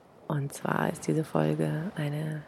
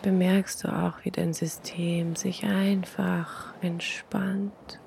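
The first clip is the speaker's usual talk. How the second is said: guided meditation; 6 dB quieter than usual. Acted. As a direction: breathe it out softly, long pauses.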